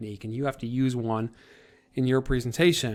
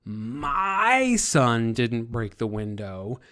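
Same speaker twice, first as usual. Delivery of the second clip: "My" drawn out long and punched louder, slow line; stress on My; 4 dB louder, much slower than usual. Shown as a demonstration. Teacher showing students how emphasis shifts guilt, stress on "My". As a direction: lean hard and long on "My". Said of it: "if you emphasize certain words".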